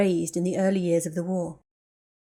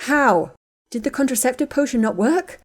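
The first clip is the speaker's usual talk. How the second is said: horrified shock; 5 semitones higher, 6 dB louder than usual. Acted. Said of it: "stared at Ginny in horror".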